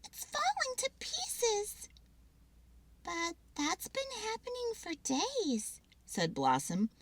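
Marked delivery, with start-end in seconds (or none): 0.0-1.9 high, slow, softer squirrel; alarmed little squirrel; worried squirrel. Acted. 3.0-5.8 high softer squirrel voice; matter-of-fact little sister. Acted. Blossom squirrel.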